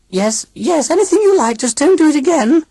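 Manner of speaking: high begging machine voice; pleading robot aunt. A narrator's reading. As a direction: beg like a scared machine. Grinny pleading.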